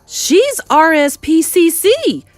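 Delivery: high, slow, loud protest; incredulous cry. Acted. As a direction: cry out each letter in disbelief. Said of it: "cried Mister Jenkins".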